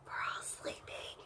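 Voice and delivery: voiceless spooky whisper; eerie whisper. Pretend. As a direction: hush it like a secret. Mock ominous whisper.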